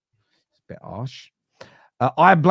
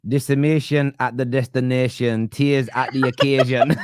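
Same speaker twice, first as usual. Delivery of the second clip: normal talking voice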